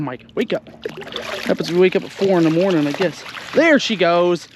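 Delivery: ordinary talk, nothing marked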